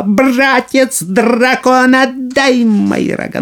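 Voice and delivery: high, slow crowing yell; rooster shout. Acted. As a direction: yell with all his might. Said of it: "как закричит во всё петушиное горло".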